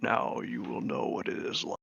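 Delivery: lightly voiced two-headed giant; two bickering giant voices. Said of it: "It's one every other word".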